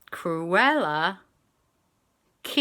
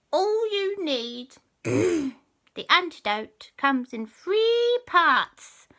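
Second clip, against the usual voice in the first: very high, slow, loud witchy bargain; sly and drawn out, bargaining; high cat witch; 13 semitones higher, 8 dB louder, much slower than usual. Acted. Cat witch demanding a favour from the children, high and sly.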